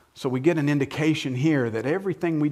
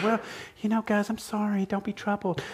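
higher, softer apologetic voice; sheepish; 8 semitones higher, 5 dB quieter than usual. Shown as a demonstration. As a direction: say it timidly, like you're apologising. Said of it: "This isn't just a sheepish kind of".

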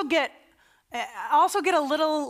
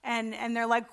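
normal talking voice

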